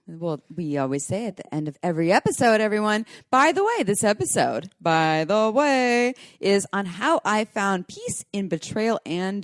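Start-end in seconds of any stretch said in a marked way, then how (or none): 3.3-3.8 high catchphrase. Real, not acted. Episode catchphrase, first take. 4.8-6.1 BY THE WAY stretched very slowly, higher; drawn-out catchphrase. Real, not acted. Host teasing this episode's topic, slow drawn-out BY THE WAY.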